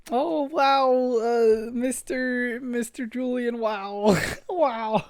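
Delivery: high-pitched, drawn-out student voice; gushing student. Acted. Student finds teacher streaming online, high mocking gush. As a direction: squeal it like a starstruck kid. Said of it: "A student would be like".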